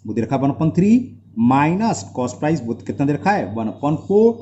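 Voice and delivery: usual speaking voice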